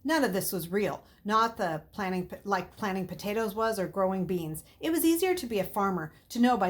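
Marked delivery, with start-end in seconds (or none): none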